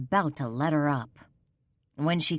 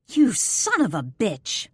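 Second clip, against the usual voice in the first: furious; 4 semitones higher, 4 dB louder than usual. Acted. Struggling, furious at Jaeger.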